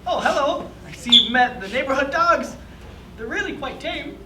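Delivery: lightly voiced elderly man; a cheerful old grandpa. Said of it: "an elderly grandpa voice calls out".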